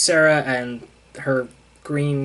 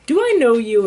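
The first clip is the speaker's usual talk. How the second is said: higher, louder mock puzzlement; puzzled Luke; 9 semitones higher, 6 dB louder than usual. Luke questioning Sarah, mock puzzled. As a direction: ask it like you're caught off guard. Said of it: "And Luke's like".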